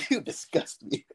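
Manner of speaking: laughing playful disgust; laughing mock disgust. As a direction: echo it through a laugh. Echoing friend's line, laughing.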